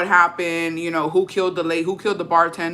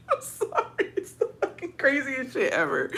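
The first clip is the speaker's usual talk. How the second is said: high, soft wheeze through real laughter; helpless laughing; 5 semitones higher, 8 dB quieter than usual. Real, not acted. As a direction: barely get the words out for laughing. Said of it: "I shouldn't be laughing".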